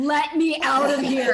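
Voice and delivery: laughing let me out; laughing plea. Real, not acted. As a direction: beg while laughing. Laughing plea about the booth.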